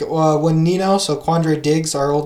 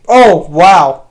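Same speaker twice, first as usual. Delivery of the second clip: much louder sudden "oh, wow"; surprised; 12 dB louder than usual. Real, not acted. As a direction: genuinely caught off guard by the number. Checking the episode number.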